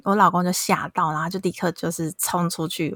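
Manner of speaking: ordinary delivery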